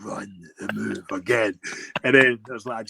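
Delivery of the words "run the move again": slow deadpan. Retold or imitated. Deadpan repeat.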